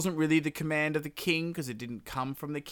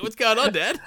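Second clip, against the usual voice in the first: high, louder baffled son; confused son; 9 semitones higher, 7 dB louder than usual. Retold or imitated. Rama to his silent dad, high puzzled call. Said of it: "He's like".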